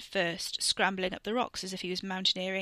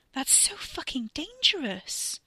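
aghast; 8 semitones higher, slower than usual. Acted. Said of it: "Catherine was aghast".

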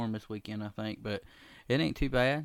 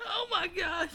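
laughing hard; slower than usual. Real, not acted.

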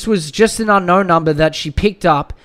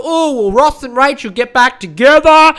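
mock excited TV fan; 7 semitones higher, 7 dB louder than usual. Mocking the couple watching Friends.

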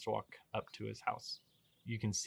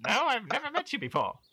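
pompous baron voice; 8 dB louder than usual. Acted. Baron indignant.

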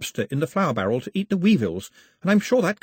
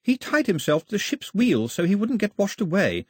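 all in one breath; 9 semitones higher than usual. A narrator's reading. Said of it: "all in one breath".

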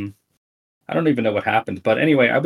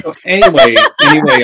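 loud laughing through the words; 7 semitones higher, 12 dB louder than usual. Real, not acted. Laughs after Transformers joke.

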